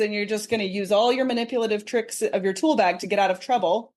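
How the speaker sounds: ordinary talk, nothing marked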